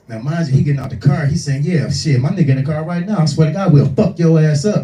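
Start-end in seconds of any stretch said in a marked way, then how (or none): 1.6-4.8 loud retold threat; threatening. Driver outside the car on the phone, threatening. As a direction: raise the voice, swearing a warning down the phone.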